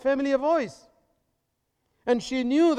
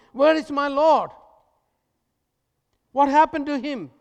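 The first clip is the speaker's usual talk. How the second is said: raised, pleading search for her Lord; Mary's distressed cry; 6 semitones higher, 6 dB louder than usual. Acted. Preacher voicing Mary at the tomb, anxious and raised. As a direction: call out as if searching the empty tomb.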